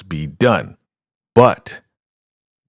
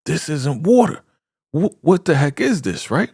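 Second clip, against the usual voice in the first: alarmed; 9 semitones higher than usual. Retold or imitated. Victim realises it is not water.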